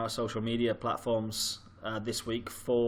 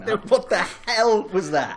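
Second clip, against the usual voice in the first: loud, raised, drawn-out disbelief; incredulous outburst; 8 semitones higher, 6 dB louder, slower than usual. Real, not acted. Host ranting about Samsung sideline gimmick, incredulous outburst. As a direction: stretch the question in exasperation.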